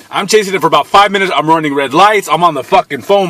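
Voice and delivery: ordinary talk, nothing marked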